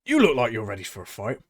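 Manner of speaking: showman voice. Acted. DM voices Tabaxi recruiting.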